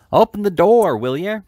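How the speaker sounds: loud, high demand through the door; rough boy shouting to be let in; crying out. A narrator's reading. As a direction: bellow it at the shop door. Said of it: "cried the voice".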